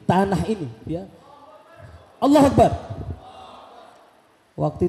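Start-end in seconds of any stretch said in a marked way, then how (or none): 2.2-2.7 takbir keras dan tinggi; seruan takbir. Real, not acted. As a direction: serukan takbir sekuat tenaga. Memimpin takbir jamaah. (penceramah mengajak jamaah bertakbir, berseru keras)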